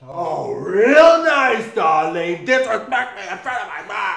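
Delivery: loud, high, drawn-out outrage; angry sarcastic retort. Acted. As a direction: fire back, stung and indignant. Acted quarrel, stung reply.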